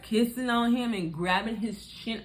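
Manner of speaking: usual speaking voice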